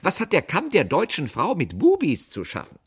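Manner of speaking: high, loud, affronted questioning; icy indignation. A narrator's reading. Aunt challenging the comb's name, icily indignant. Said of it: "forschte meine Tante eisig".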